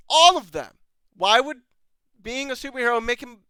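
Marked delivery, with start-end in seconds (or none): 0.1-0.7 "All of them." repeated much louder, punched on "All". Real, not acted. Fed-up reviewer repeats himself, "All of them." louder on "All". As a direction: hit "All" hard the second time, louder.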